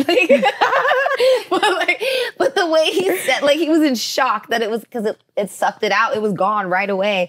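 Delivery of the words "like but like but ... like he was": high, loud laughter through the words; cracking up at the memory; laughing hard. Real, not acted. Guest telling the lipo story, laughing hard.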